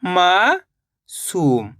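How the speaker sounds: a teacher stretching मासूम slowly for the class; drawn-out blended word. Shown as a demonstration. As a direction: stretch मासूम very slowly, each sound held. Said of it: "तो मिलके बन जाएगा".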